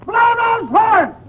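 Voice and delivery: high, slow holler; comic shouting. Acted. Frightened patient demanding noise around him, high comic shouting. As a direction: call out each word high and stretched. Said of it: "there's no sense in shouting".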